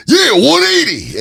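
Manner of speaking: high, loud slow raspy cry; Macho Man impression. Macho Man answering Gene, high raspy yell. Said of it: "And you just hear".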